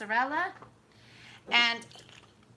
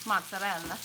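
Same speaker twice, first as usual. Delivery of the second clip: mozzarella in a lower Italian accent; 4 semitones lower than usual. Shown as a demonstration. Joking it is the only word she can say, Italian accent. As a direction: toss off mozzarella with an Italian lilt. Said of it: "I would only be able to say".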